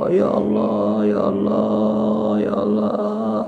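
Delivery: high, drawn-out pleading call; tearful longing. Acted. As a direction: cry out to God in acted yearning. (meratap rindu memanggil Allah)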